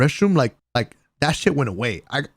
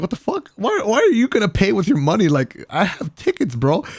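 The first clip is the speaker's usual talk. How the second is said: incredulous; 9 semitones higher, 3 dB louder than usual. Retold or imitated. Retelling confusion at bar offer.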